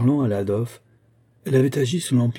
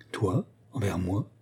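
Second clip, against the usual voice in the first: soft, slow questioning; slow, hushed surprise; soft disbelief; 6 dB quieter, slower than usual. A narrator's reading. Ariane answers Olga's confession with soft disbelief. Maid reacts to mistress's confession.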